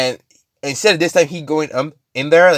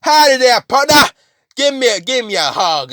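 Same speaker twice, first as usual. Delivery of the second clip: high, loud hammed-up cowboy; Carnage; 12 semitones higher, 4 dB louder than usual. Carnage rushing a villain, whooping cowboy holler. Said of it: "Carnage's like".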